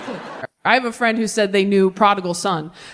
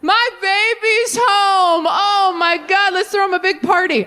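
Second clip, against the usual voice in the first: high, slow, overjoyed dad; higher doting dad, slowly gushing; 9 semitones higher than usual. Retold or imitated. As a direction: coo like a doting father welcoming his son home. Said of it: "And the dad goes".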